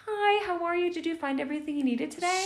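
high, bright till greeting; chirpy cashier greeting a customer; customer service voice. Retold or imitated. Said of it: "I turn to the reg".

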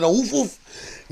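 high, drawn-out pretend woofs; mock dog bark. Joking that the lady should bark at her dog, high mock woofs. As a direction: imitate a dog's woof. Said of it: "throw a bark in there".

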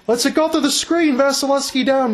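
sudden surge of commentator excitement; excited play-by-play. Real, not acted. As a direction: lift the pitch as the shot comes. Live call of a shot.

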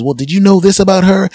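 high, loud tattling; catty gossip. Retold or imitated. Lady A exposing Lady B, high and catty. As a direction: spill the dirt loudly. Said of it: "Lady A says".

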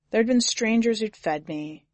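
ordinary delivery, nothing marked

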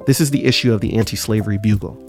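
ordinary talk, nothing marked

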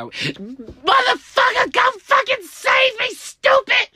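furious, very high shriek; exasperated screaming. Acted. Kid to slow-witted mom, enraged screaming. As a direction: scream it at top pitch in desperate fury.

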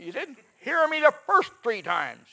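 mother's loud retort. Retold or imitated.

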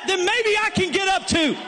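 shouted hopeful realization; hopeful sinner. Acted. A fallen person daring to hope, shouting. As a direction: cry out a new hope.

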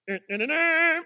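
high loud fussing sobs; mock baby fussing. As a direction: imitate the crying baby. Imitating fussing crying baby.

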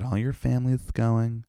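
high, sing-song camp take on mom's text. Retold or imitated.

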